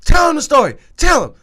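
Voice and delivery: high, loud insistent demand; imitating a drunk friend badgering his girlfriend; mock loud urging. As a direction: push her to tell it, loud.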